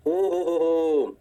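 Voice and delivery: very slow, drawn-out "oh-ho-ho"; mock awe. Teacher reacting to the self inductance result, drawn-out mock awe. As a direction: stretch it out as if marvelling.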